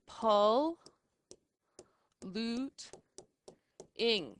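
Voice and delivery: pol, loot, ing said apart with pauses; teacher sounding out pol, loot, ing; split into syllables. Shown as a demonstration. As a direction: sound out polluting one syllable at a time. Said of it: "separate the double letters".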